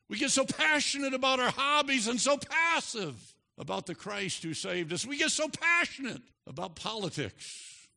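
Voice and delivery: loud, high, climbing sermon cry; preaching at full force. Acted.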